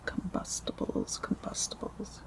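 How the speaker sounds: very soft whispered echo; hushed ASMR word repetition; whispered repeats. Real, not acted. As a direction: whisper it, fading away.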